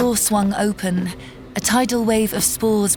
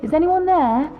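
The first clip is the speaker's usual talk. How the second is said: plumber calling out through the house, higher; 6 semitones higher than usual. A narrator's reading. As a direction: call into the house, higher pitched. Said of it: "he shouted inside".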